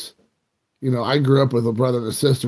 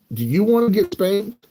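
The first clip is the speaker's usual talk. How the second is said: higher-pitched pretend warning; hammed-up threat; mock parental threat; 8 semitones higher than usual. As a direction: play an indignant dad warning his kid. Retold father threatening his son.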